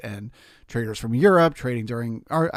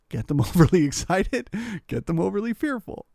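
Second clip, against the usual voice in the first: higher, with a laugh on "overly"; 7 semitones higher than usual. Shown as a demonstration. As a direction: laugh through the repeat.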